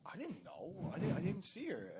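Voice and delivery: high-pitched pleading protest, acted out; retold defensive excuse. Guest excusing himself to annoyed Sal, high defensive plea.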